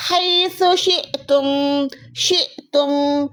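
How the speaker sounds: शिअतुम chanted slowly, hamza read; slow recitation. Shown as a demonstration. Teacher repeats the example for the students, slow chant. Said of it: "सिर्फ हमजा को पढ़ना है".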